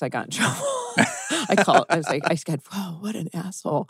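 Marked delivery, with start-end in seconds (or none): 2.7-3.9 soft muttered aside, recreated; under the breath. Retold or imitated. Retelling a cuss at a teacher, under the breath. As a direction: drop the volume as if not meant to be heard. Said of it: "under my breath".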